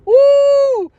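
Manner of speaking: high, loud whoop; triumphant whoop. Real, not acted. A shout after calling out the family's church. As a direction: whoop like you won.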